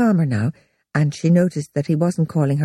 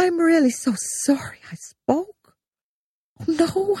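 hushed, higher embarrassed whisper; mortified whisper; 12 semitones higher, 3 dB quieter than usual. Acted. Heidi whispering an apology to Greg after the slip.

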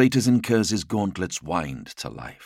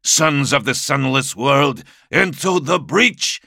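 roaring; 9 semitones higher, 5 dB louder than usual. Acted. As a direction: roar it over the battle. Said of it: "Captain Malkarian roared".

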